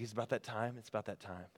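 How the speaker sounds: hushed, low and very soft; barely audible, jittery-crew whisper. Acted. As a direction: murmur it secretly among the crew. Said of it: "they start to whisper".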